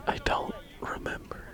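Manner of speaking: breathy ASMR whisper, no voice at all. Real, not acted. Commentator whispering to viewers, ASMR style. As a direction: whisper it close to the mic like ASMR. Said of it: "Is that like A S M R for you guys?".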